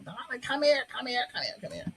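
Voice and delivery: high-pitched call from across the house; mother yelling. Retold or imitated. Mother calls daughter across the house, high, insistent call. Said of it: "She's yelling at me across the".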